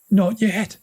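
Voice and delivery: high, tense warning; urgent. A narrator's reading. Big brother warns they're still chased, high and tense. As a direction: warn him it's not over. Kieran voiced.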